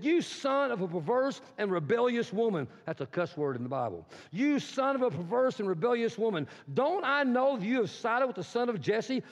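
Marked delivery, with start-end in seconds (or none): 0.0-2.7 raging father's curse; Saul's angry insult. Acted. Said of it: "Saul's anger flared up". 4.3-9.2 raging father's curse; Saul's angry insult. Acted. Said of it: "Saul's anger flared up".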